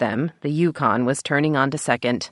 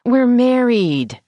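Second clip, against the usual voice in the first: whiny; 10 semitones higher, 5 dB louder, slower than usual. A narrator's reading. Whining wife pleads.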